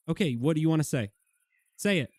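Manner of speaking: mock-tough dare at a chicken; mock confrontation. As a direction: taunt the chicken to speak up. Daring the chicken to talk.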